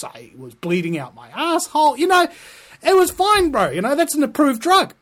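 sarcastic patient. Acted. Patient listing pharmaceutical side effects, breezy mock voice. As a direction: list the side effects like it's nothing.

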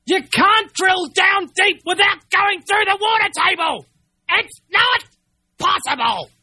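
high, slow, outraged shout; exasperated outburst at the fracking company; angry shout. Real, not acted. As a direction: yell it at the liars. Furious rant at company.

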